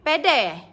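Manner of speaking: "pede" loud, both e short, falling; Indonesian pede. Shown as a demonstration. Host showing viewers how Indonesians say pede. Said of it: "pede sebutan dia".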